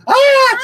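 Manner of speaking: high, loud scared mother's shriek; mama's scream. Retold or imitated. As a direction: shriek like the panicking mama. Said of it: "the mama's sitting over there going".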